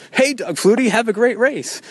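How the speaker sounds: high, eager fanboy call; goofy homeboy. Retold or imitated. Goofy homeboy greeting Doug Flutie in Athletes Village, high and goofy. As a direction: gush like a goofy fan. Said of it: "goofy homeboy who says".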